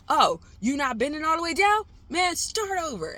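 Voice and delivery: high, loud coach barking orders; mock coach yell. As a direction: yell it like a gym coach in a movie. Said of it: "Those movies where they're like".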